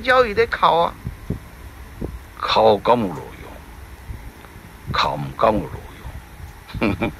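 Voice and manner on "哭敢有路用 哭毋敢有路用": very slow, low rhetorical question; lower, heavy remark; slow and grave. Real, not acted. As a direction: let the words drag, grimly. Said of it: "narrator's grim remark".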